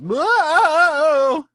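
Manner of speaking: wild hammed-up howl; crazed moan. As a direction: let out a wild crazed wail. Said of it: "He's just".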